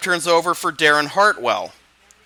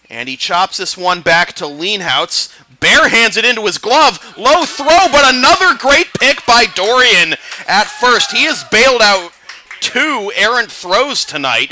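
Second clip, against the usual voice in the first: louder, rising call of a great fielding play; excited play-by-play; 6 semitones higher, 6 dB louder than usual. Acted. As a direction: sell the pick at first with building excitement. Calls the play live.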